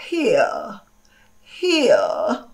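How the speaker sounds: here said British, the r dropped, HEE-uh. Shown as a demonstration. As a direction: say here like a Brit, no r. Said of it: "Se fosse o britânico, talvez ele dissesse".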